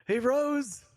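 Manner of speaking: high-pitched pretend amazement; mock awe. Friend announces a resurrection, mock awe. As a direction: proclaim a miracle in a high voice.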